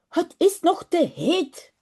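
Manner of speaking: put-on a mild child's cry that it's too hot; lightly voiced child calling out. Little boy Jip tells mother the bath is too hot, lightly called out. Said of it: "roept hij".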